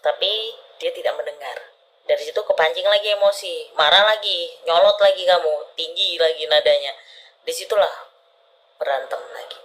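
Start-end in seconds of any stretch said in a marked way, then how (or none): none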